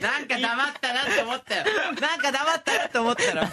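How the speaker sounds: high, laughing protest. Real, not acted. Laughing outburst.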